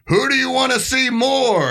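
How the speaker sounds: high slow announcer call to the crowd; mock MC. Organizer polling the crowd on the mic, high announcer voice. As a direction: ask the crowd like a hype man. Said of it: "I hear him go".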